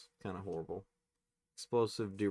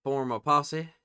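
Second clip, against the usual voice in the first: put-on loud, higher-pitched southern accent; 5 semitones higher, 9 dB louder, slower than usual. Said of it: "Let me use my southern accent".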